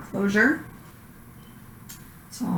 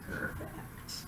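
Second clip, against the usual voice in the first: whispering; 22 dB quieter than usual. Real, not acted. Praising patient during exam.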